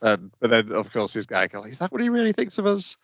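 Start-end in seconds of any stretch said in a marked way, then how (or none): 1.7-3.0 high, fast, wounded voice; mock hurt gang member voice; hurt henchman. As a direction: squeak it out like a stung underling.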